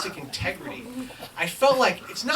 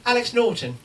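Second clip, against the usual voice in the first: louder, higher put-on English accent; 5 semitones higher, 5 dB louder than usual. Disguised writer gives a false name to reception, English accent. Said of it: "I'm speaking in an English accent".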